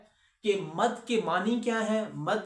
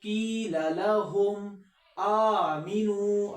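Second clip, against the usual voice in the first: slow melodic tajweed recitation; Quran recitation; 2 semitones higher, much slower than usual. Shown as a demonstration. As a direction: recite slowly and carefully.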